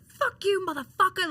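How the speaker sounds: high, loud re-enacted cursing; frustrated outburst. Wrestler re-enacts cursing at her mentor, loud frustrated outburst. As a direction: hurl it back at the coach, acted out. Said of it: "And I was like".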